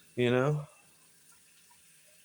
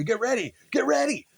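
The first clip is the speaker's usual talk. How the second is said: very high, frantic urging; panicked urging; 11 semitones higher, 4 dB louder than usual. Retold or imitated. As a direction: hurry him like a frazzled stagehand. Organizer panicking backstage.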